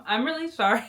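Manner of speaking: giggling through an apology; laughing apology. Real, not acted. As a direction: laugh it off, sheepish. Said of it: "looks funny how I'm speaking".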